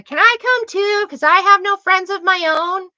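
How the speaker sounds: put-on high, whiny kid voice begging to come along; mocking little sibling. Mimicking Lily tagging along, whiny kid voice. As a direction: whine like a clingy little sister. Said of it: "the little tag along sibling".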